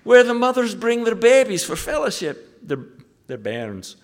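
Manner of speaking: imitating the Glaswegian pastor's soaring excitement about his church; thrilled Barry. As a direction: climb up into a giddy, breathless report. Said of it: "Then he said".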